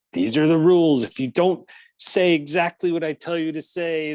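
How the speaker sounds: put-on laying down the rules like a strict kid; bossy kid quote.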